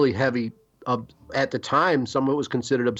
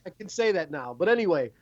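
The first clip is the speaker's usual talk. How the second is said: normal talking voice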